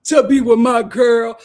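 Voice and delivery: loud, slow put-on imitation; mock boyfriend answer. Boyfriend answers how he feels, hammed-up slow declaration. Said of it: "when Ariana said, "How do you feel?".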